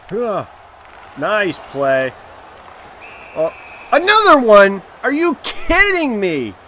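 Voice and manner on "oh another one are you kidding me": loud, high-pitched incredulous protest; outraged disbelief. Real, not acted. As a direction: cry out at an unfair call. Outraged at another penalty called.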